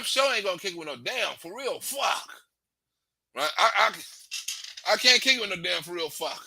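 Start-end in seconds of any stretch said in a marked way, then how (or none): none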